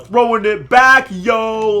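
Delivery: loud, high, drawn-out shout; excited holler. Real, not acted. Streamer cheers a callback to an old series, drawn-out holler. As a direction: belt it out like a hype man.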